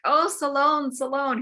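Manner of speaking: condemned king calling out from the fire; crying out again. Retold or imitated. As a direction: repeat the name as a desperate cry. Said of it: "He repeats the name".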